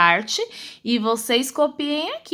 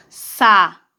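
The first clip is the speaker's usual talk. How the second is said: three syllables with clear gaps; sílabas separadas; 4 semitones lower, 6 dB louder than usual. Shown as a demonstration. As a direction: dictate salada as SA, LA, DA. Teacher dictates syllables while writing. (ditado sílaba por sílaba)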